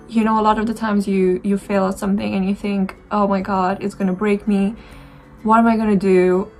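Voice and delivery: ordinary talk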